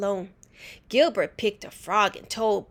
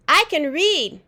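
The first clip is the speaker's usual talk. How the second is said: put-on little girl voice; 8 semitones higher, 7 dB louder than usual. A narrator's reading. Little sister boasts.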